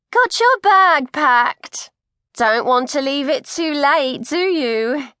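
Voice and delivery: concerned coat check lady asking a fainting pregnant woman; kindly coat check lady. Acted. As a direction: fuss over her like a kindly auntie.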